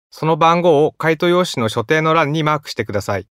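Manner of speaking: ordinary talk, nothing marked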